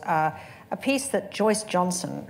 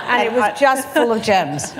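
louder, real laughter through the words; laughing; 7 semitones higher, 6 dB louder than usual. Real, not acted. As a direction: laugh out the delight. Laughing at the Trump quote.

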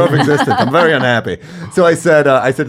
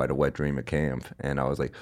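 ordinary delivery

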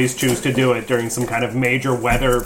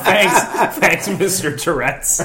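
laughing; 7 semitones higher, much slower than usual. Real, not acted. Laughing at a joke.

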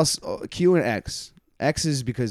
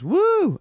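high, loud mimicked whoop; imitated woo; 6 dB louder than usual. Comic mimics lady wooing at his age, high loud woo. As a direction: imitate a woman whooping from the crowd. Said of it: "this lady was also wooing at everything".

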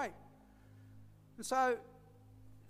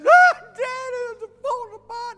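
very high, very loud crying wail; acted bawling; 25 dB louder, slower than usual. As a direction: cry out like a man falling apart. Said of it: "and he's bawling".

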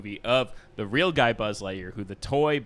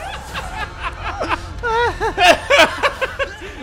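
high wheezing laughter; cry-laughing helplessly; laughing to tears; 3 dB louder than usual. Real, not acted. Still laughing, near tears.

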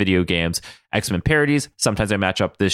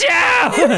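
screaming laugh; 5 dB louder than usual. Pretend. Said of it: "before I started screaming".

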